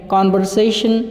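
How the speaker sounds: कन्वर्सेशन with the R sounded, kanvarseshan; American pronunciation. Shown as a demonstration. Teacher showing the American version to students, R sounded. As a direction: roll in the R in कन्वर्सेशन, American style. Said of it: "कन्वर्सेशन कहेंगे तो वो American में जाएगा".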